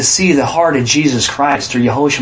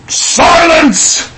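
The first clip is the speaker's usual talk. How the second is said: much higher, loud commanding shout; booming command; 11 semitones higher, 4 dB louder than usual. Acted. God silencing Peter, a loud shout. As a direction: cut him off like thunder.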